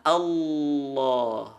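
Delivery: "Allah" very slow, with a long dark l; thick lam. Shown as a demonstration. Teacher modelling the lafaz, drawn-out thick "Allah". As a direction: dwell on the heavy l of "Allah". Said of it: "lam dibaca tebal".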